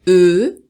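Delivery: E said as a long rounded schwa; French E symbol sound. Shown as a demonstration. Teacher showing students a phonetic symbol, French E sound. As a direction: hold E as the French neutral vowel. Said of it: "o símbolo do".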